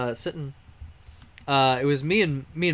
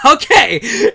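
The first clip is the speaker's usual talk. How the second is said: high, loud, eager "Okay!"; eager shout; 9 semitones higher, 12 dB louder than usual. Retold or imitated. Kid eagerly shouting okay to his friend's challenge, excited. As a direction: yell it like a kid ready to play. Eager yes to a BB gun fight.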